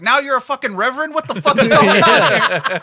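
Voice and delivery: fast, high and loud, laughing at the end; outraged yell. Retold or imitated. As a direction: blow up in baffled outrage. Guy outraged at sudden blessing.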